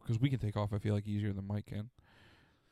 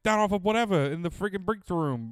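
loud, high mock New York speech; Mike's New York accent while chatting with a stranger; 7 semitones higher, 5 dB louder than usual. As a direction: do the New York guy giving directions. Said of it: "His accent comes out sometimes".

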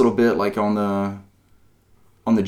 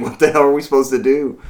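higher, louder reenacted bewilderment; mock exasperation; 6 semitones higher, 6 dB louder than usual. Player recalling a chaotic boss fight to co-hosts, mock exasperated. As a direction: replay being stumped, voice raised.

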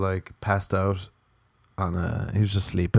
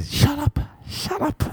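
very high, squeezed protest; mock outburst; slower than usual. Sleeper snapping at own brain, high mock outburst.

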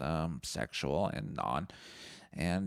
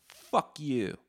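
loud retold snap at the shop owner; mock fuck you; 8 semitones higher, 4 dB louder than usual. Podcaster retelling telling Gord off, loud mock snarl. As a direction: spit it out, faking contempt. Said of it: "I'm like".

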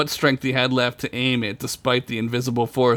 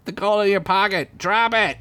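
high-pitched frantic commands; urgent shout; 8 semitones higher than usual. A narrator's reading. Chickpea shouting to Duncan, urgent high cry. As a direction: call out orders in a raised voice. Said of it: "she shouted".

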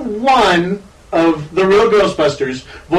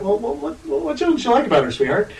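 gentle parent; 4 semitones higher, 6 dB quieter than usual. Retold or imitated. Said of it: "And I'm like".

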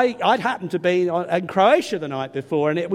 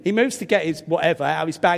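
normal talking voice

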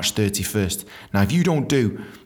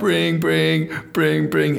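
raised, trilled mock ringtone; imitated phone ringing; 5 semitones higher, much slower than usual. Caller waits on the boss's landline, imitated ringing. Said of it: "So I'm ringing".

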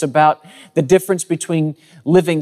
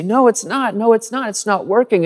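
put-on higher-pitched kid insisting, repetitive; protesting child; 6 semitones higher than usual. Third grader contradicting his mom about the project, whiny protest. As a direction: imitate a little boy arguing back. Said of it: "And I would say".

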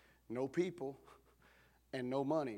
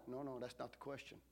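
ordinary delivery

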